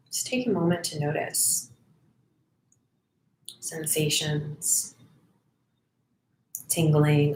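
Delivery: soft, unhurried meditation voice with long pauses; guided meditation. Acted. Volunteer leads online listeners into noticing breath and body, guided meditation. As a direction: guide them gently inward, phrase by phrase, leaving silences.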